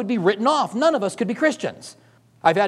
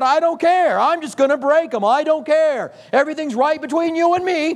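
put-on defiant child; 7 semitones higher than usual. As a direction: play a stubborn kid who won't back down. Child insisting defiantly, voiced higher.

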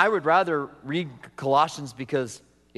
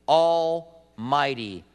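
normal talking voice

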